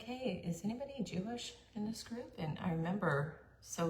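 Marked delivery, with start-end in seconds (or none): 0.0-2.3 high, soft and overly sweet; sweet asking voice. Retold or imitated. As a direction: ask innocently, almost sing-song. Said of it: "I'm like".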